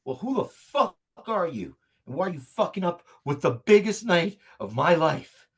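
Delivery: indignant villain. Acted. Villain demands who ruined his big night, indignant put-on voice. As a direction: rant in hammed-up indignation.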